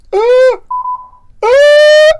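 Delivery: piercing, very high shriek; high scream. Pretend. Streamer scaring himself on camera, loud high scream. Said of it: "that's scary".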